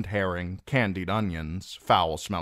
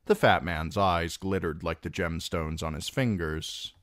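usual speaking voice

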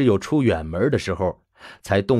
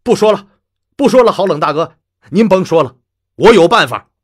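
loud and higher, overwrought; losing control; 8 semitones higher, 8 dB louder, faster than usual. Acted. Upset father cutting off Mr Leng, loud and overwrought. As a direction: blurt it out, no longer able to hold back. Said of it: "情绪已经无法控制".

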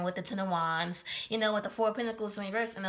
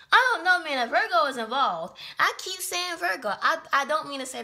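flustered outburst; 6 semitones higher, 7 dB louder than usual. Real, not acted. Flustered by repeated slip.

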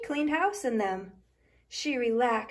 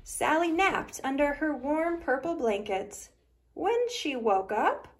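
usual speaking voice

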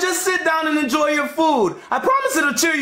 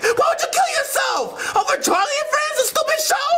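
high-pitched exasperated outburst; incredulous scolding; 10 semitones higher than usual. Acted. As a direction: berate him in sheer disbelief. Berating friend over suicide attempt.

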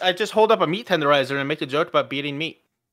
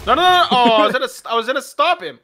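loud, high-pitched protest; raised, hurried objection; urgent interjection; 6 semitones higher, 6 dB louder, faster than usual. Real, not acted. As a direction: jump in to cut someone off. Frantically interrupting the other's turn.

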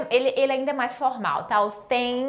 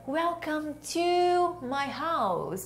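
higher, very slow and sustained; drawn-out model phrase; 5 semitones higher, 4 dB quieter, much slower than usual. Shown as a demonstration. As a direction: stretch "to" out long. Same drawn-out song-like model phrase. (bem alongado e mais agudo)

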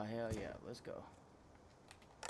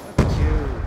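loud taunt; 3 semitones higher, 16 dB louder than usual. Real, not acted. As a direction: jeer loudly at the loser. Mocking beaten enemy.

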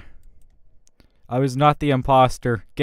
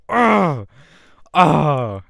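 frustrated groan; 5 semitones higher, 6 dB louder than usual. Real, not acted. Furious after being voted out.

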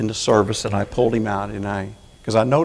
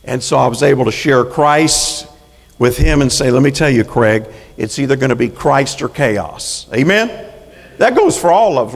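loud, raised pulpit voice; full-force preaching; 6 dB louder than usual. Acted. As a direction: preach it out to the congregation.

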